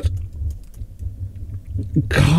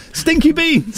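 show title; 10 semitones higher, slower than usual. Pretend. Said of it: "That's what she calls her show".

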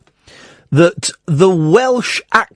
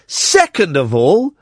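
usual speaking voice

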